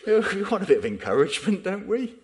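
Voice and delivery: high, quick, loud squeal of complaint; mock protest. Preacher voicing listeners wanting encouragement, high-pitched mock protest. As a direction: plead in a high, hurried mock whine.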